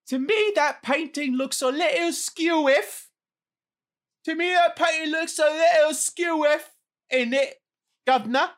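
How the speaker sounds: high, hammed-up British accent. Co-host mocks British phrases, high cockney accent. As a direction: play it up as a high-pitched cockney Brit. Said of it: "I'm hitting all the accents".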